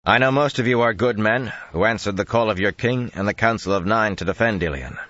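booming voice. Acted. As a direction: carry the words to hidden men. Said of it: "so they boomed against the wall".

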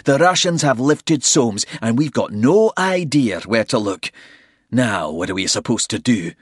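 loud, exasperated rant; frustrated complaint. A narrator's reading. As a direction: vent the frustration loudly. Bald frustrated.

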